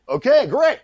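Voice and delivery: high, loud, eager agreement; imitated eagerness. Eager reply to director.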